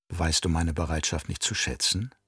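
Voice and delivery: low, soft woman's voice; quietly enticing question; seductive bargaining. A narrator's reading. Jessica seductively bargains with captor.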